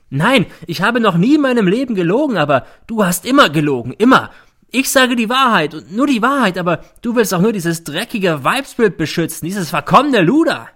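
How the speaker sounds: high, loud furious tirade; bellowing in rage. A narrator's reading. Mother raging at her son in court, bellowing. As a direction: let the outrage pour out. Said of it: "brüllt sie plötzlich los".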